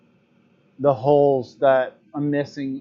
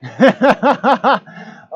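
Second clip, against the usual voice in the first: big laugh; 9 semitones higher, 6 dB louder than usual. Real, not acted. Laughs at own bad audio.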